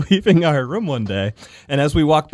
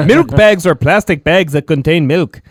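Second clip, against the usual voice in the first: louder mock Canadian read; Canadian accent; 4 semitones higher, 6 dB louder than usual. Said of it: "it's getting weirder and weirder".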